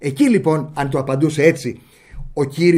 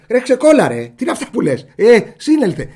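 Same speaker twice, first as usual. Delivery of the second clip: loud mock scolding of a friend, jumpy pitch; calling a friend out; 6 semitones higher, 6 dB louder than usual. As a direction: snap at your buddy to snap out of it. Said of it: "Δεν λέτε σε ένα". (φωνάζει στον φίλο του να συνέλθει)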